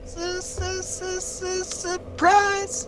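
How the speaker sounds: high sing-song drawn-out surprise; falsetto announcement. Acted. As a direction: stretch it out in a squeaky falsetto.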